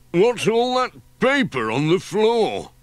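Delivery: a startled workman looking at the mess; Ted's surprised call. Acted. Ted the handyman sees paper on the floor, surprised loud voice.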